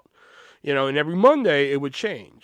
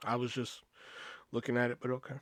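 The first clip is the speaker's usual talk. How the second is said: soft, lowered meek reply; meek mumble; 3 semitones lower, 11 dB quieter, faster than usual. Retold or imitated. Clerk answering his rude boss, soft and meek. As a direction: shrink back and mumble.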